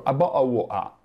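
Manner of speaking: "Abbo, awo, a" slurred into vowels; "Abbo, awo, a" as a Londoner blurs it; London mash-up. Shown as a demonstration. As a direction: mash the phrase into vowel sounds. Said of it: "solo una B con vari suoni".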